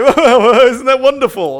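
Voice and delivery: mock gushing, very high and loud; fake delight. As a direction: gush with forced cheer at the child. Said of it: "you'd just be like".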